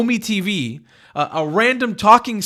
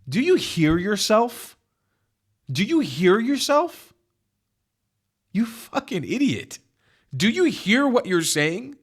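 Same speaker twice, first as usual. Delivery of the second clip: slow, drawn-out disbelief; slow incredulous scolding; 3 semitones higher, slower than usual. Real, not acted. Host asks debater if he hears himself, slow incredulity. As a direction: spell it out slowly, as to an idiot.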